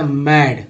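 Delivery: मैड with a short open "a" like "mad"; मैड clipped for contrast with मेड; pronunciation model. Shown as a demonstration. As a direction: say मैड short and flat-vowelled. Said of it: "ए का उच्चारण ए हो जाता".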